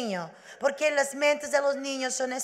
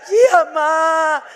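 slow, sobbing promise, louder; crying child; 3 semitones higher, 10 dB louder, slower than usual. Retold or imitated. Frightened girl tearfully promises her mother to behave. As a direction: whimper a teary yes.

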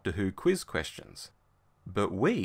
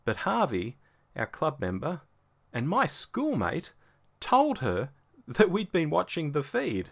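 normal talking voice

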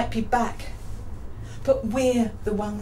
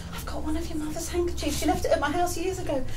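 quoted sister-in-law; 6 semitones higher than usual. Retold or imitated. Sister-in-law at the book launch, lightly imitated. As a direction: lightly voice an eager messenger.

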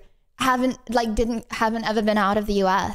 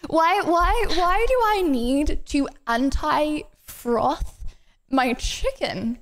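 slow, word-by-word disbelief; drawn-out rhetorical bafflement; incredulous question; 4 semitones higher, much slower than usual. Real, not acted. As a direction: stretch the question out with a pause before the end. Incredulous rhetorical question about the ingredient.

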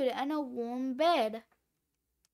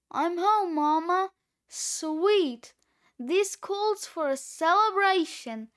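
ordinary delivery, nothing marked

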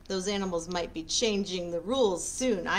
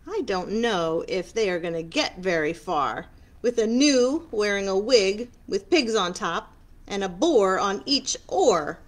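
usual speaking voice

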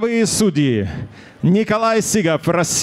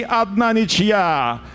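ordinary talk

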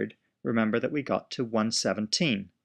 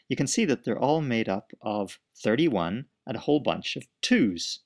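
ordinary delivery

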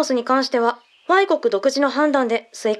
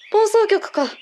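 laughing; 6 semitones higher, slower than usual. Acted. Laughs at student joke.

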